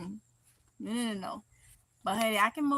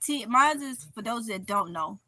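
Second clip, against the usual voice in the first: normal talking voice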